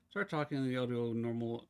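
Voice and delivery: ordinary talk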